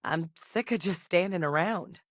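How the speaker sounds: ordinary delivery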